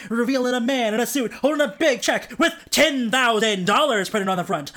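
plain reading voice

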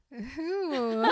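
high squeaky laughing "who"; clueless laugh. Retold or imitated. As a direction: dissolve into a giggle on the question. Gretchen's clueless reaction.

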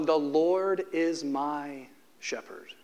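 slow reading. Real, not acted. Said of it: "We read verse one".

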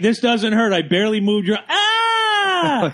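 high, hammed-up shriek at the end; mock pained yelp. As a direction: fake a dramatic ouch. Mocking whiny arrestee.

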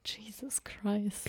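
soft slow whisper. Real, not acted. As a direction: breathe it under your breath. Whispered aside after slip.

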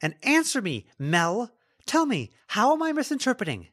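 loud, high, insistent questioning; angry demand. Acted. Lila demands an answer, gripping collar.

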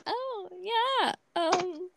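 high, soft, uneasy little voice; mock your own helpless awkwardness; awkward reaction. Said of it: "I was like".

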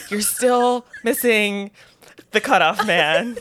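imitating a coach straining for calm; mock restrained anger.

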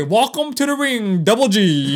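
ring announcer. Retold or imitated. Host mocks a fight announcer calling out Double G, booming. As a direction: announce him into the ring like a boxing emcee. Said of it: "ain't no one in sports history always say".

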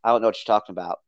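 a hint of fake innocence; innocent denial. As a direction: play it innocent, brushing it off. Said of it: "Muffy's like".

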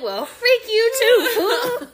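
high, loud, slow, laughing tough-guy voice; mock-tough laughing comeback; playful tough retort. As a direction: bark it back with love. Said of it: "I'm like".

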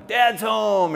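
high-pitched delighted greeting; excited child. Acted. Kids running to tackle their dad, excited.

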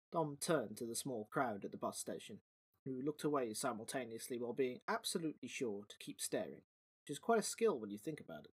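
low, soft storyteller read; story narration. A narrator's reading. Narrator reading the story to podcast listeners, low and soft. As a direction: settle in and tell the tale quietly.

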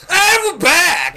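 high, slow screamed "And we're back"; screamed announcer. Acted. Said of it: "you want to scream in on one".

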